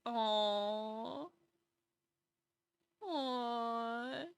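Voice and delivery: soft cooing aw at a sweet pet; hushed aww over a brave cat; tender aw. Real, not acted. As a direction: melt a little on each aw.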